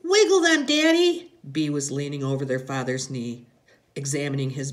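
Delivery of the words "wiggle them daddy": put-on high, loud child's voice; little girl's high, loud demand to her daddy. A narrator's reading. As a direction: pipe up as an eager small daughter. Little girl voiced in story.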